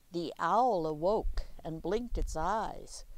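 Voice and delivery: plain reading voice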